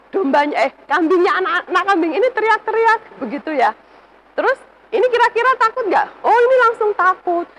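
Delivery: ordinary talk, nothing marked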